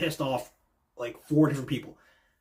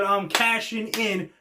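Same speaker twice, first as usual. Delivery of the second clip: declaring cash-in; 7 semitones higher, slower than usual. Retold or imitated. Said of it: "and said".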